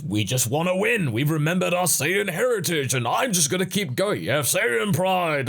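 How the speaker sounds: mock-heroic declaration; mock Saiyan boasting. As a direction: parody a stubborn, proud fighter. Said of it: "as they've said quite a lot of times".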